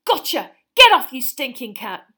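high, loud yell; shouting. A narrator's reading. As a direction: yell after the rat. Ron grabs rat, yells.

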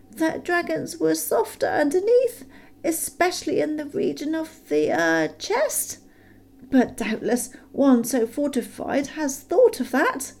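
high, timid hobbit squeak; frightened squeak. A narrator's reading. Bilbo probing the dragon, frightened squeak. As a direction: squeak it out nervously. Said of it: "in a frightened squeak".

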